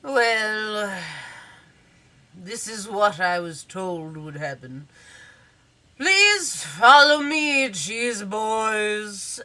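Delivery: drawn-out, measured butler voice; formal butler. Acted. Butler leading the cheese boys in, drawn-out formal voice. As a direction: play a put-upon butler, stretching the final call. Butler character answers and leads guests.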